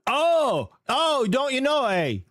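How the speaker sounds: high, drawn-out mock Canadian; Canadian accent. Streamer teasing about Canada's A, mock Canadian accent. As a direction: stretch out a hammed-up Canadian lilt. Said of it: "without the A all the time".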